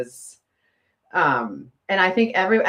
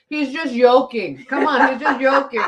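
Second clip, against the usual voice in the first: high, loud mock Spanish accent, laughing; hammed-up Spanish-accented mimicry; 8 semitones higher, 5 dB louder than usual. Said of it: "like, mock him".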